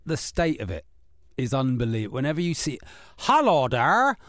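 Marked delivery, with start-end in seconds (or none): 3.2-4.2 loud, high, exaggerated West Country burr; hammed-up generic Devonshire voice with swooping vowels; mock Devonshire accent. Host mocking bad screen accents, hammed-up Devonshire. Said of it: "Devonshire accents in anything".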